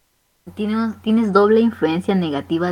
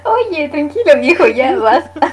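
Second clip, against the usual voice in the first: high laughing protest, loud; 9 semitones higher, 8 dB louder than usual. Real, not acted. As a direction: push back through laughter.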